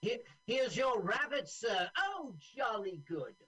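high, loud upper-class English; posh British accent. Pretend. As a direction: play a cheery English gent. Voicing British film characters.

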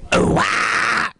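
a comic yelp pretending to clear the throat. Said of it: "I need to clear my throat".

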